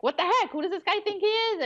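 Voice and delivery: high-pitched mock indignation; reactive ego. Ego reacting to another driver, high mock indignation. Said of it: "the ego will be reactive".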